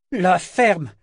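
high, loud, piercing order; piercing yell. A narrator's reading. Eta silences Althea, yelling piercingly. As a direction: cut her off with a sharp cry. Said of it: "cria la putain d'une voix perçante".

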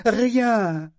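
a high, loud cry that cracks; voice breaking. A narrator's reading. Parangon insists they know nothing, voice cracking. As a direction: let the word break apart. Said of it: "Sa voix se brisa tout à coup".

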